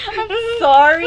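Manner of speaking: high, loud apology through laughter; laughing apology. Real, not acted. A girl apologises for her laughing fit, still laughing. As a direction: apologise while still cracking up.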